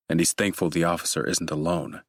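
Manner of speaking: usual speaking voice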